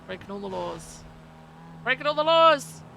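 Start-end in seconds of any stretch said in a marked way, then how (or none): 1.8-2.8 gleeful shout. Acted. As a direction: shout "Breaking all the laws!" out loud and higher. Repeats the line as a shout.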